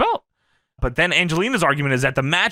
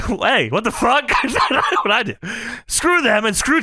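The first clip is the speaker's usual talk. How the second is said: mock shout; 7 semitones higher than usual. [name] reacts to insult.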